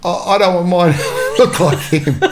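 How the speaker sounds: retelling the mate's words through laughter; mild laugh breaking into the line; laughing quote. Retold or imitated. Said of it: "And Stavros said".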